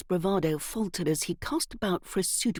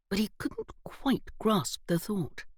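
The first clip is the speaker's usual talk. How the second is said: usual speaking voice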